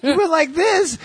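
hammed-up irate comic ranting about his wife; Bill Burr rant. Retelling a Bill Burr bit in his high, angry voice. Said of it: "He talks like he's fucking pissed off".

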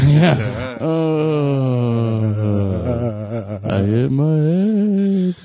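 slow, sung-out ghost wail; wavering ghost voice. Pretend. Guest joking as a ghost who fell, wavering ghost wail. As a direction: wail woefully, stretching every vowel like a mock ghost. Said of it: "the wavering ghost voice".

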